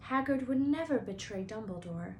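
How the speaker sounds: ordinary delivery, nothing marked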